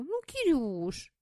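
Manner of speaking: slow whiny plea; nasal whine. Acted. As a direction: whine his name like a pleading wife. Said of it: "заныла Сэм".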